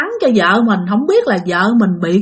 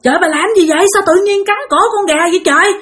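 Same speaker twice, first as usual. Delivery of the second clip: high-pitched, hurried horrified scolding; frantic husband, pitched way up; alarmed outcry; 9 semitones higher, faster than usual. Acted. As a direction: act out crying out in horrified disbelief. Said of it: "Cái ổng mới la làng luôn".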